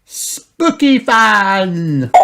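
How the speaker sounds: loud, high, very drawn-out phrase; Peppa. A narrator's reading. Peppa answering Granny about fun, high stretched-out voice. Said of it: "asked Peppa".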